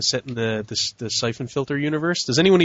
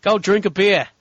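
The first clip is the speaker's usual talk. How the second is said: a higher, louder mock Australian bark; mock Aussie; 7 semitones higher, 3 dB louder, slower than usual. Teasing Australian listeners, mock Australian accent. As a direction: play a cartoon Australian. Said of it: "continuing the mock Aussie bit".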